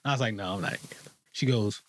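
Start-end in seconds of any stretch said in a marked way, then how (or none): 0.3-1.1 softer, dropped-down denial; quiet denial. Retold or imitated. Telling the therapist about his parents, a soft guarded denial. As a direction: play it small and a little guarded.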